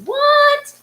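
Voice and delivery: high, loud amazed what; surprised what. Real, not acted. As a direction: squeal your disbelief. Host reacts to amazing act.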